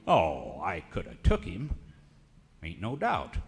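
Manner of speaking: normal talking voice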